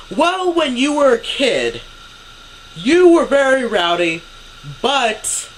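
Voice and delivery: high, loud mock-mom voice; imitated near-yelling. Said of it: "she's pretty much yelling".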